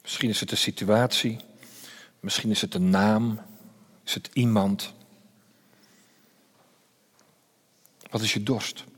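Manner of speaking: softly spoken meditative questioning; quiet guided reflection. Acted. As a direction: lead them gently into the silence to feel their thirst.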